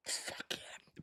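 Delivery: real, barely audible voiceless whisper; winded whisper. Real, not acted. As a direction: whisper with no breath left after smelling salts. Reeling from smelling salts.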